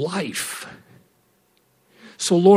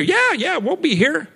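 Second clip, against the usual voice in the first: high, over-keen promise; pitched-up, enthusiastic yeses; eager agreement; 12 semitones higher than usual. Retold or imitated. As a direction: imitate people readily agreeing. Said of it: "what a lot of people used to say".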